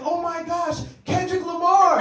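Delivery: high, loud excited fan shriek; starstruck fan. Retold or imitated. Mock fan squealing at him in China. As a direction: squeal like a fan spotting a star.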